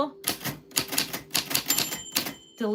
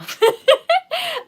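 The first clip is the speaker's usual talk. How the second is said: a loud high burst of laughter; loud high cackle; 10 dB louder than usual. Real, not acted. Laughing about chips not food.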